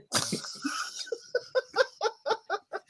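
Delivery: airy high-pitched laugh; breathy high laugh. Real, not acted. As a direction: laugh without voice at his own joke. Laughing at Eurovision joke.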